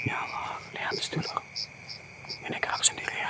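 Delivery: frightened whisper, barely voiced; whispered prayer. Real, not acted. Ghost hunter whispering prayers as the thing moves. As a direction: breathe the words, afraid to be heard.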